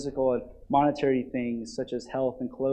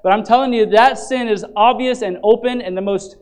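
loud, higher, pleading pulpit voice; impassioned preaching; 8 semitones higher, 10 dB louder than usual. Acted. Pastor urging the church not to get angry, impassioned preaching. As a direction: plead with them, full force. Passionate sermon on sin.

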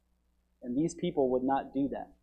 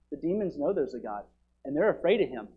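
usual speaking voice

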